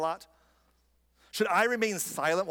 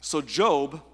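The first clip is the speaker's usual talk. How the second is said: usual speaking voice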